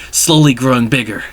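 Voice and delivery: plain reading voice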